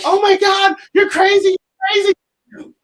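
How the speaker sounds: high, loud shriek of panic; freaked-out scream. Retold or imitated. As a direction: scream it like a terrified girlfriend. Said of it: "his girl was like".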